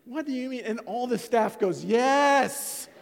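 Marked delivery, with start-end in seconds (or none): 0.0-0.6 high-pitched, baffled question; incredulous protest. Retold or imitated. Husband answering wife's public accusation, incredulous. Acts his disbelief at the accusation. 1.9-2.9 loud, high shouted confirmation; emphatic chorus agreement. Retold or imitated. Church staff backing the wife's claim, emphatic.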